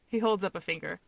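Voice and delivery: ordinary delivery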